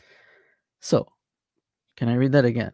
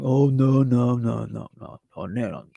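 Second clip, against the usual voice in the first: mocking deniers. Imitating individuals he's tired of, droning mockery that fades. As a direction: let the no's blur and die away. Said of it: "all these individuals saying".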